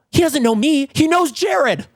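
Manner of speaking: high, frantic squeaky panic; panicked squeal. Retold or imitated. Hiding from the neighbour, panicking in a high squeal. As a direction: squeal it like you've just been caught.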